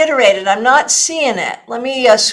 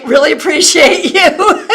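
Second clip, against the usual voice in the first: laughing; 5 semitones higher than usual. Real, not acted. Laughing while signing off.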